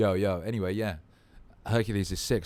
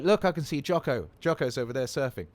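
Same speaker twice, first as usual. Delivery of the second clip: lightly voiced excited kid, higher; imitated young son; 5 semitones higher, 5 dB louder than usual. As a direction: mimic a small boy pointing something out. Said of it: "He's like".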